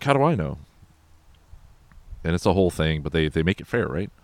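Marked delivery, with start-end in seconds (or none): 0.0-0.6 suspicious question. Retold or imitated. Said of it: "He's like".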